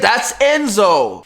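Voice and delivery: high, loud and drawn out; slow, thrilled cry of recognition; excited spotting. Retold or imitated. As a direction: blurt it out as you recognise him. Spotting the dog driving past.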